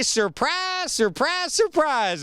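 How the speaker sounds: high-pitched, drawn-out country surprise; Gomer. Acted. Host quoting friend Gomer's old catchphrase, high-pitched Gomer impression. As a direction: crow each word up high, imitating a goofy catchphrase. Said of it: "As my friend Gomer used to say".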